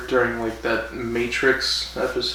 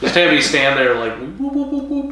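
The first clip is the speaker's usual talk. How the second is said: high, silly whoop whoop noises; mock mental battle whoops; 3 semitones higher than usual. An actor mocking fight scenes, whooping high. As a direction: ham up an actor's lazy psychic fight. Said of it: "some of the actors go".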